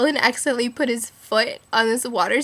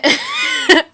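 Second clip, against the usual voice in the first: high, loud squealing laugh; shrieking laugh; 6 dB louder than usual. Real, not acted. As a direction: lose it in a piercing laugh. Recalling whale fart joke.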